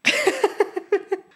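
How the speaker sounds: a quick high embarrassed giggle. Real, not acted. Laughing at her own mix-up, a quick high giggle. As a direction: laugh at the slip.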